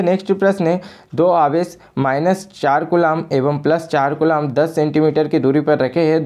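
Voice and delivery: ordinary talk, nothing marked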